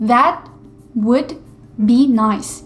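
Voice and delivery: each word apart: "That", "would", "be nice". Shown as a demonstration. Teacher showing how a learner expects to hear it, word by word. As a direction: say "That would be nice" with full words and pauses between. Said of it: "이렇게 들려야 되는데".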